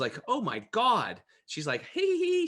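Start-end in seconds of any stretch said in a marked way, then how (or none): 0.3-1.2 amazed. Retold or imitated. Retold amazement after drinking water. 1.9-2.5 high, loud imitated giggle; excited giggle. Imitating the excited water lady's high giggle. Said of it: "She's all excited".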